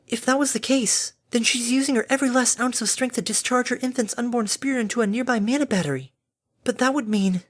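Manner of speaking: high character voice, thinking aloud; reasoning Fluttershy, growing worried. Acted. Fluttershy works out what the labor means, worried.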